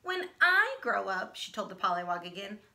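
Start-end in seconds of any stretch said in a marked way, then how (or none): 0.0-1.4 slow, loud, higher show-off boast; drawn-out proud announcement; braggy. Acted. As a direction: brag it out like she's the only one. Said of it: "She's such a braggy brown".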